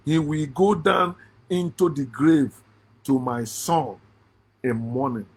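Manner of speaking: put-on low, fading, grieving old father; mournful Jacob. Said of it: "this is Jacob now to show you".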